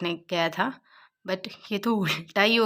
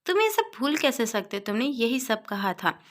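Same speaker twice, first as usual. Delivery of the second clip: higher, indignant outburst; angry; 6 semitones higher than usual. Retold or imitated. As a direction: throw the accusation back at him. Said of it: "युवान गुस्से में कहता है".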